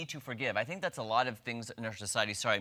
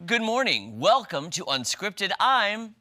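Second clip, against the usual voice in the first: slow, louder newscaster greeting; news anchor voice; 5 semitones higher, 6 dB louder, slower than usual. Shown as a demonstration. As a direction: open the show like a TV anchor. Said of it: "lessons on her news voice".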